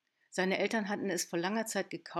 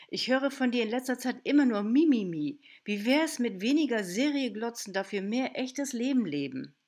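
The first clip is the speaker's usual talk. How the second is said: loud, higher, mocking Mimimi; firm telling-off; 4 semitones higher, 6 dB louder than usual. Aunt telling off moping niece, loud and mocking. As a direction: shake her out of the whining.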